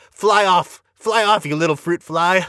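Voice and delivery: parodying a cringe film quote; mocking movie line. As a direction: ham up the clunky dialogue as a mock grand farewell. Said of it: "and then tells the guy".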